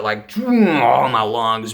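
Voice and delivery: stretched words mimicking a huge drag; slow, drawn-out mimed inhale. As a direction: drag it out as if sucking in smoke.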